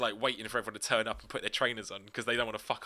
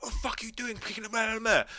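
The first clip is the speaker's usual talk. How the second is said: mock angry challenge; 11 semitones higher than usual. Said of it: "just like".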